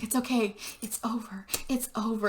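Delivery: soft, hushed retold comfort; soothing. Luke retold comforting the sobbing girl, softly soothing.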